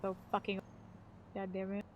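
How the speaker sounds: ordinary talk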